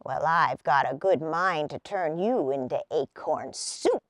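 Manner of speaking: low, sly gnome voice; wicked gnome. Acted. Oldest gnome threatening the boys, low and wicked. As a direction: grin while you threaten. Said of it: "with a wicked grin".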